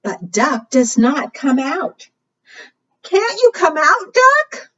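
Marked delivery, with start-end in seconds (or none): 3.0-4.7 high-pitched storybook plea; squeaky plea rising at the end; pleading call. Acted. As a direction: call out in a high, anxious little voice. Friends call to the missing duck.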